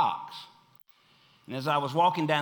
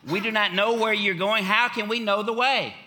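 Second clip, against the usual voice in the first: raised, emphatic quoting of Thomas; 5 semitones higher than usual. Retold or imitated. Thomas speaking up to Jesus, clear and emphatic. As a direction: press the question, pitched up. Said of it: "very clearly and very emphatically".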